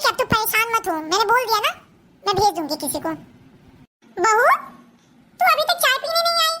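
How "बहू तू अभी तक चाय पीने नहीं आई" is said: mother-in-law calling. Acted. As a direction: call out to the bahu. Mother-in-law calls daughter-in-law. (सास की पुकार)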